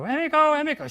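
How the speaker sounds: calling her name high and loud into the night; teacher calling for a missing student, loud. Retold or imitated. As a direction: shout her name, searching for her.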